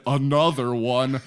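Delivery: low, slow, dejected catchphrase. Retold or imitated. Son imitating dad before the talk, sad and slow. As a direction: drag it out like a sad hype man. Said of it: "a sad [name]".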